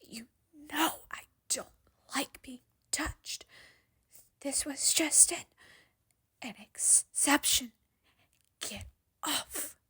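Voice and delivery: hushed and breathy, voice cracking; hissed whisper. A narrator's reading. Danny hissing at the clinging girl to let go, whispered. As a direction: whisper it through clenched panic, barely voiced. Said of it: "he hisses, and his voice cracks".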